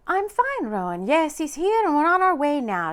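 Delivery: bursting with excitement on the phone; excited. A narrator's reading. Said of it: "getting excited again".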